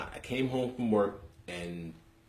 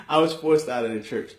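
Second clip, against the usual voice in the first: laughing through the words, louder; 8 semitones higher, 10 dB louder than usual. Real, not acted. Laughing at how it sounds, laughing. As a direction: crack up while saying it.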